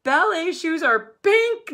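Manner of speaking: high, loud wail. A narrator's reading. Nate wailing to Dad about pink shoes, loud and high. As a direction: cry it out in despair. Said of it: "Nate wailed".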